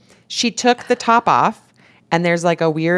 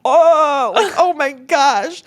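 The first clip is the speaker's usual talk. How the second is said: high, drawn-out mock horror; retold disgusted groan; 9 semitones higher, 3 dB louder, much slower than usual. Both women learn what it is, drawn-out disgusted horror. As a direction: stretch it out slowly, appalled.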